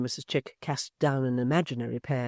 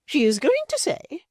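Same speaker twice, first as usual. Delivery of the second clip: high, loud indignant matron; a wounded lady turning cold and grand; high, loud offended dowager; Missus Chick, outraged; 8 dB louder than usual. A narrator's reading.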